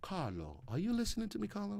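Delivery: badly faked Italian accent, played quietly. Host checks Carlo is listening, mock Italian accent. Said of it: "it may not be that good".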